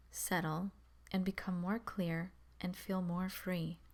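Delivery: calm, measured meditation voice with long pauses; guided meditation. Acted. Guiding beginners to focus on the breath, calm meditation voice. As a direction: settle listeners gently, leaving space between instructions.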